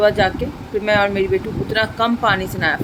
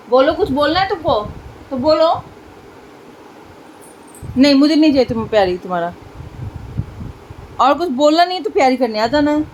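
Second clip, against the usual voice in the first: playful scolding; 4 semitones higher than usual. Real, not acted.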